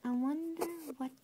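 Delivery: ordinary delivery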